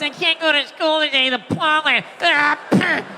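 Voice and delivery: put-on slow, pleading sickly-child whine; whiny kid. Sickly child begging to stay home, whiny and slow.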